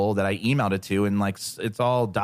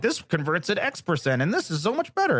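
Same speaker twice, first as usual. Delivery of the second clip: mock marketer voice; 8 semitones higher than usual. Mocking marketers' claims.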